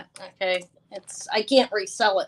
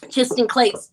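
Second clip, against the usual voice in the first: laughing; 2 semitones higher, 5 dB louder than usual. Real, not acted. Laughing while reassuring buyer.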